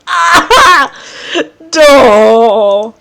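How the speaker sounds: loud, high laugh into a drawn-out aww; adoring squeal. Real, not acted. Player watching a gentle embrace, adoring squeal. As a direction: melt loudly at the cuteness.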